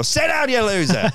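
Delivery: high, loud slow taunt; mock taunt. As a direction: jeer at the toddler, high and loud. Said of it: "then just go".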